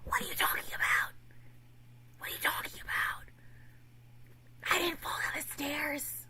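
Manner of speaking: imitating a hushed, confused denial; whispered denial. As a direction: whisper it, baffled. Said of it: "he was like".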